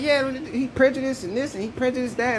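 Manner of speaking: lightly mock her telling it; mimicking her. Retelling what she said about him, in a mock voice. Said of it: "And she was like".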